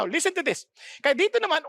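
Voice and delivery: ordinary delivery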